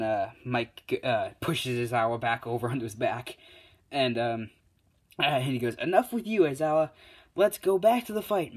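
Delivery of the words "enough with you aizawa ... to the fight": high, loud dismissal; brash, raised announcer voice; announcer cutting in. Acted. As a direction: push past the argument, voice raised high. Mike cuts off Aizawa, announcing.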